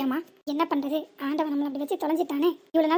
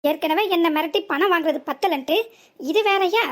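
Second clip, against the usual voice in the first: furious accusation; 5 dB louder than usual. Acted. Driver rants at the woman about her blackmail plan, furious. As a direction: lay out her whole scheme in outrage.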